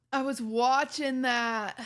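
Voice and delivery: slow, drawn-out whiny complaint; mock whiny viewer. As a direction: drag it out like a sulking fan. Mock whining viewer quote.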